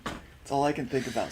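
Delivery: ordinary talk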